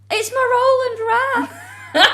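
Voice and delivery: put-on high, slow wailing child, laughing; childish wail. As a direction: wail like a heartbroken little kid. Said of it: "and I went".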